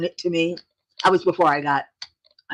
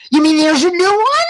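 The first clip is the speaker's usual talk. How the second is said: high, loud, astonished question; real, thrilled surprise; excited disbelief; 11 semitones higher, 7 dB louder than usual. Real, not acted. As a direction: squeal it at the news of a new book. Learns a new book exists.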